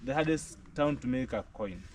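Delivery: usual speaking voice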